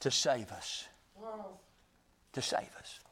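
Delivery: very low, hushed repetition; soft, reverent near-whisper; hushed awe. Real, not acted. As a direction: drop right down, nearly to a whisper. Awed repetition after building climax.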